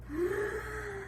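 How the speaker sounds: long demonstrated inhale; deep breath in. Shown as a demonstration. Leading children in deep breathing, long audible inhale. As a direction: draw in a big, audible breath. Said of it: "Breathe in".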